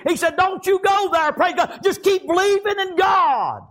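high, sustained preacher's exhortation; full-force preaching. Real, not acted. Preacher voicing Paul's urging to Timothy, full-force preaching. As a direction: exhort them at a high, driving pitch.

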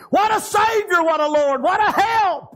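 high, shouted burst of praise; exultant praise. Real, not acted. Preacher praising his Savior to the congregation, exultant shout. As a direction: cry it out like a revival preacher.

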